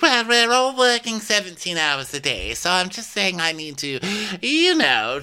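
very high, put-on protest; Faye impression voice. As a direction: argue up high, imitating Faye. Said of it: "She's like".

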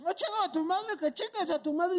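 high, laughing mock insult; mocking tamale man. Retelling the tamale man's insult to the panel, high laughing mockery. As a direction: ham up the rude guy's comeback. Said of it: "que me dice".

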